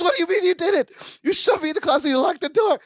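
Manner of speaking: indignant accusation, lightly voiced; mock outraged roommate. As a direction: play the outraged roommate, half joking. Larry's outraged accusation, retold.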